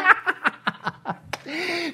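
high, mocking laugh at the pipsqueaks; God's scornful laugh. God mocking the world's rulers, a scornful laugh. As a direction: laugh them off like they're nothing. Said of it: "Look at these little pipsqueaks going".

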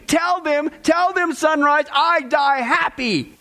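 high, rising, slowing ecstatic call; rapturous plea. Retold or imitated. Dying man urging friends to tell others, ecstatic. Voicing dying man's last words.

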